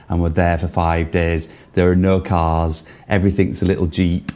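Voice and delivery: ordinary talk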